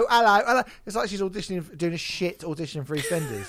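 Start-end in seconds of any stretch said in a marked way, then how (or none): 0.0-0.6 high, loud fake Cockney hello, hello; mock an American's terrible Cockney accent; bad Cockney accent. Critic mocking the singer's fake Cockney in a loud, high voice. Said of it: "shite Cockney accent".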